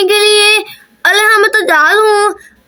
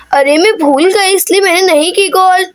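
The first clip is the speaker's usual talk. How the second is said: ordinary delivery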